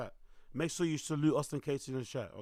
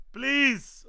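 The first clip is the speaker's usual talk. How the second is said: loud mock plea; 9 semitones higher, 10 dB louder than usual. Begging chat to like the stream.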